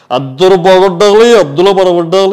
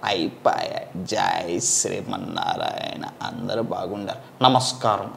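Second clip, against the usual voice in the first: much lower, much softer, slow blessing voice; mimicked swami; 11 semitones lower, 17 dB quieter than usual. Mimic blesses the audience in a much lower, softer voice. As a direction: murmur the blessing like an old holy man.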